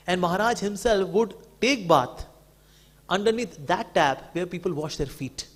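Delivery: ordinary delivery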